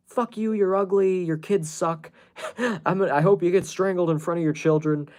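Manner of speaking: hammed-up angry tirade, cracking up midway; mock angry insults. Mimicking someone who throws insults when angry, mock angry. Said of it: "be angry and then start saying".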